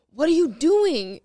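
retold abrupt accusation; imitating accusing partner. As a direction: call out like a startled sleep talker. Acted sleeper waking her up.

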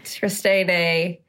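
normal talking voice

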